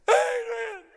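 tearful bellowing boxer; Rocky. Acted. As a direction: cry out the name, hammed-up. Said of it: "He's crying".

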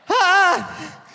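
loud, high mock anguish; mock breakdown. Comic to audience, hammed-up anguished cry. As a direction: ham up falling apart over the cut.

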